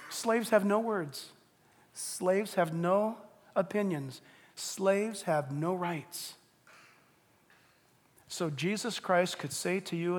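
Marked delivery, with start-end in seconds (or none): 0.1-6.3 slow, deliberate, each line weighed; slow emphatic sermon line. Acted. Preacher telling the congregation about slaves, slow and solemn. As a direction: let every word land slowly. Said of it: "preacher's slow emphatic triple".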